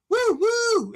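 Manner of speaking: high loud train-whistle woo woo. Shown as a demonstration. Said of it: "that train is going".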